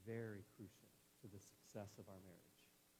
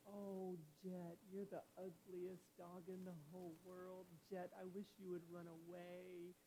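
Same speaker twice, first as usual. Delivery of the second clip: high, slow, singsong pet-talk voice; sweet talk to dog; 6 semitones higher, slower than usual. Shown as a demonstration. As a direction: coo insults as if they were lavish praise. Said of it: "Tone is very important to this dog".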